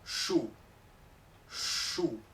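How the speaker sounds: "shu" con sh soplada; "shu" con más aire que "xu"; sh fuerte. Shown as a demonstration. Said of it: "La S H es más fuerte que la X". ("shu" con sh fuerte)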